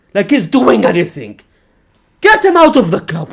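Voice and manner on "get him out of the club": higher, louder rant; angry demand. Real, not acted. Fan fed up with Bellingham, angry demand. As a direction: demand it in frustration.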